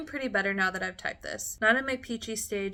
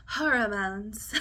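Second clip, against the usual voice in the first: teary laugh; 10 semitones higher than usual. Real, not acted. Crying while writing journal.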